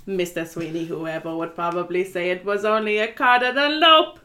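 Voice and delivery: hammy Italian correction; Italian accent. Retold or imitated. Pirelli quoted in accent.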